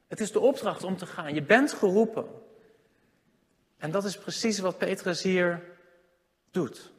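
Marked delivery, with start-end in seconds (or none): none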